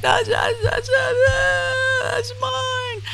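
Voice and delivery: high-pitched hammed-up wailing; mock crying. Imagining running up to a stranger over a hoodie, mock crying. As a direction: pretend to bawl in a tiny high voice. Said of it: "I'll be crying".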